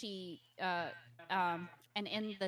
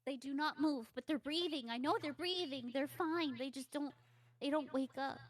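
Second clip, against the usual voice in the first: put-on higher, insistent little-girl voice; frightened child; 6 semitones higher than usual. Captive girl describing the sleepers, anxious and insistent.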